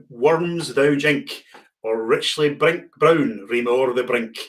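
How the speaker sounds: slow, measured Scottish verse recital; Scottish bard's toast, lingering on each phrase; best Scottish accent. Shown as a demonstration. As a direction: recite it slowly in broad Scottish, falling away at the end. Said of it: "do it in my very best Scottish accent".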